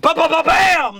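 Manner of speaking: high, punched explosion noise; imitated explosion. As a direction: burst it out like rapid blasts.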